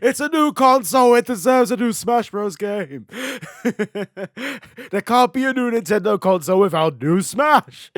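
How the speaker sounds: loud, high excited ranting; excited fan rant. Acted. Fan demanding new Smash.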